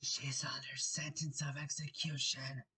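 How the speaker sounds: soft, secretive whisper. Acted. Rabbit on tiptoe confiding in Alice, whispered. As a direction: whisper it close to the ear. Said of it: "and whispered".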